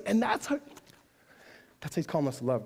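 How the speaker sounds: ordinary talk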